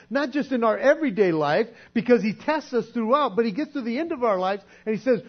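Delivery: ordinary talk, nothing marked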